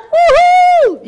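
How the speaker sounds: a very high, loud whoop of delight; joyful whoop. Retold or imitated. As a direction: cheer like you just found the fix. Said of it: "you're like".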